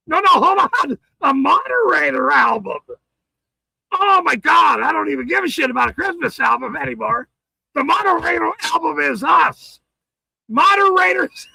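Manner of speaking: loud, fast, overexcited rant; excited shouting. Real, not acted. Streamer hyping chat's joke album, shouting. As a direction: yell it out, thrilled by the joke.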